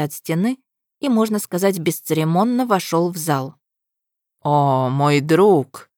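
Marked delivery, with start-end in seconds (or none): 4.4-5.9 slow, drawn-out ironic greeting. Acted. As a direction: stretch it out with mock warmth. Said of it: "в ироничной усмешке". (иронично и медленно)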